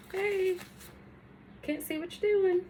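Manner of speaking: high-pitched mock audience voice; imagined audience member. Viewer telling the crafter the view is blocked, mock audience voice. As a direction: pipe up high, imitating a viewer calling out. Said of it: "without an audience telling me".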